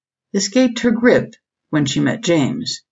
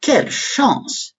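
high, slow French exclamation; French accent; 8 semitones higher, slower than usual. A narrator's reading. Bistrot hostess delighted for the American visitor, French accent. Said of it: "in a thick French accent".

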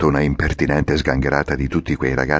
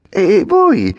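high, louder puppet voice; surprised question; Pinocchio surprised; 6 dB louder than usual. Acted. Pinocchio asks the coachman. (Pinocchio sorpreso, voce alta)

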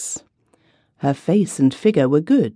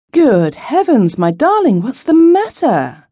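high, louder alarmed call to his wife; alarmed cry; 8 semitones higher, 4 dB louder than usual. A narrator's reading. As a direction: burst out in alarm at her tears. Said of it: "I cried".

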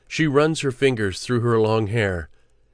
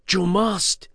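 much higher, insistent push; urgent command; 8 semitones higher than usual. Acted. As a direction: force him to act, pitched up high. Claudia urges the frightened Anup.

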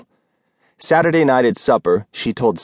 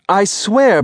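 landlady insisting to Rose, sharply raised; emphatic vow; 10 semitones higher than usual. Acted. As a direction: swear it with sudden heat. Landlady vows, repeating herself.

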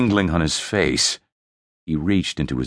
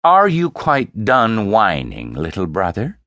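condescending; 4 semitones higher, 4 dB louder than usual. Acted. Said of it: "she asked".

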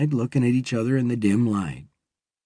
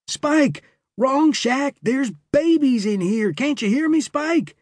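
urgent calling; 13 semitones higher than usual. A narrator's reading. Steve shaking dying partner awake.

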